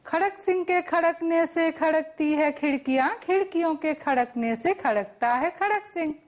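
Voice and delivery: quick, even rattle of a tongue twister; fast tongue twister. Shown as a demonstration. Teacher challenging students to match the speed, rapid tongue twister. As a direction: rattle it off without a break. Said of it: "હું જેટલી ઝડપથી બોલું".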